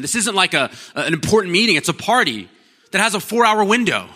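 high, loud, fired-up preaching; full-force preaching. Acted. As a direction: drive it home to the crowd.

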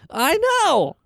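squeaky, high, drawn-out "I know"; high-pitched "I know". Shown as a demonstration. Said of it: "How does she say it?".

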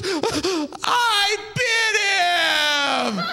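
high, drawn-out mock wailing confession; gasp, then wail it slowly at high pitch; crying wife. Wife confessing to husband about the baby, hammed-up crying. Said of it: "my wife is sitting there crying".

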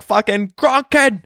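high-pitched Boston holler, mock yell; Boston accent call-out. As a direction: hail someone across a crowd in Bostonian. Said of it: "Dude, I was like".

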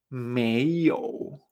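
"meiyou" rising, then dipping low; slow teacherly "meiyou", up then low; tones two-three. Shown as a demonstration. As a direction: lift "mei" and sink "you". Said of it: "la combinación dos tres".

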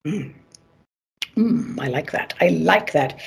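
low, just after a sip; while sipping a drink. Real, not acted. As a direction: savour the drink while talking. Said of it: "Let me have a sip of my drink".

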